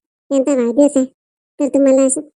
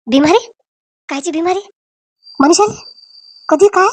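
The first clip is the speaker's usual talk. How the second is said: rising, higher, startled questions; stunned, asking what happened; shocked disbelief; 4 semitones higher than usual. Acted. Mother shocked to hear her daughter is ill.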